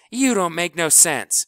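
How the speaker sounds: loud, high angry shout. Acted. Kleebo shouts over House, loud and angry. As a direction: yell him down. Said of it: "shouted Kleebo".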